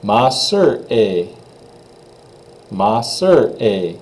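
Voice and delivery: Ma sœur est slow, falling on sœur; slow teacher demo; French pronunciation. Shown as a demonstration. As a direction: model Ma sœur est slowly. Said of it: "Then letter N".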